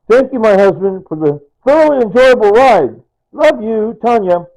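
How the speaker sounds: high, loud declaimed verse; heartfelt card reading. Retold or imitated. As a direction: read it out big and high, proclaiming it. Rereads his late wife's card.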